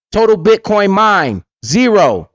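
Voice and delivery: mock report readout. Reading out a disastrous report.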